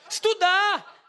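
shouted answer, pitched high; emphatic shout. Real, not acted. Preacher answering his own question to the congregation, emphatic shout.

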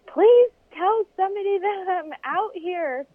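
high, laughing plea for help; pleading. Retold or imitated. Stranded racer begging the crew, high and laughing. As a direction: beg them, half laughing at yourself. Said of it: "And I'm like".

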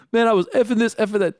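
lightly mock the friend who keeps swearing; lightly mimicked buddy. Imitating swearing buddy then scolding.

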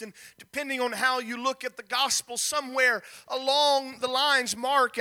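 usual speaking voice

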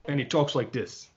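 softly attempted Irish accent, badly faked; terrible Irish accent. Host imitating Conor McGregor's speech, badly faked Irish accent. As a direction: have a poor go at an Irish accent. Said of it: "He talks way more Irish sounding. That was a terrible attempt".

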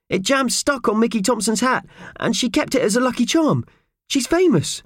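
high-pitched little character voice, explaining; eager Purvis. Acted. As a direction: tell the story in a very high voice. Purvis explains about the cart.

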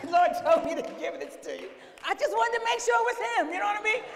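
high, shouted comic protest; reluctant handover. Retold or imitated. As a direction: explain loudly, hoping for a way out. Retells reluctantly handing over his pay.

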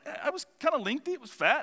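lightly voiced coach, sounding unsure; coach's voice. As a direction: imitate the coach describing the snake. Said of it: "She's like".

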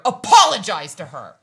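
very loud imitation of sister yelling; loud yelled order. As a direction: bellow the command as an irate sister. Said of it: "that was probably so loud".

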